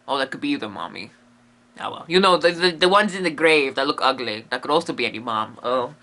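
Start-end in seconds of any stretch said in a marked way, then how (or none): none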